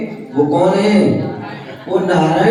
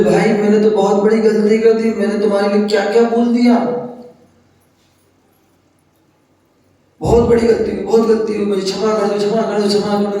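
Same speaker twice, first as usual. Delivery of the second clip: higher-pitched, mildly acted begging; retold pleading apology; 4 semitones higher, much faster than usual. Lakshman falls at Ram's feet begging forgiveness, lightly acted pleading. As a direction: plead for forgiveness in a raised pitch, repeating urgently. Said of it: "उनकी आंखों से आंसू आने लगे, हाथ जोड़ लिए".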